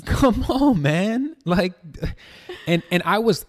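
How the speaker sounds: high, loud laughing protest. Real, not acted. Man laughing at women's confessions, laughing. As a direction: burst out incredulously through real laughter. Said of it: "I was laughing as I'm laughing now".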